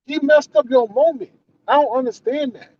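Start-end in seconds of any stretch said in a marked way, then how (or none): none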